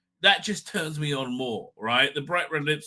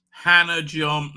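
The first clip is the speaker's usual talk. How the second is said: drawn-out, careful name; slow name reading; 4 dB louder, much slower than usual. Acted. Host sounds out a contestant's name, slowly. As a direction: stretch each syllable of "Hannah John".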